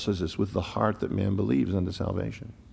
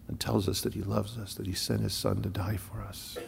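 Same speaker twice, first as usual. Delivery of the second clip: hushed appeal; 9 dB quieter than usual. Acted. Preacher softly pleading with the unbeliever, hushed. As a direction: draw the room in with a hush.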